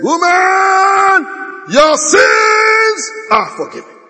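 high, loud, slow ringing declaration; high proclaiming shout; Jesus' shouted proclamation. Acted. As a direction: cry it out over the crowd like a prophet.

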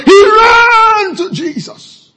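high, loud, slow preacher's cry. Acted. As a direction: wail it to the congregation. Preacher crying out climax.

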